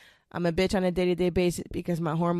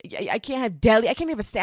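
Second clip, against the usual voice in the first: ordinary delivery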